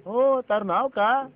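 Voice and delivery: slow, loud, high question; insistent asking. Acted. Asking the scolder's name, loud and insistent. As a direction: draw the question out loudly. Bickering, asks name loudly.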